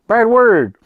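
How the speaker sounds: put-on high, loud kid voice; imitated little sister. Retelling the sister's answer, high loud kid voice. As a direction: belt it out like a stubborn child. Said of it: "She's like".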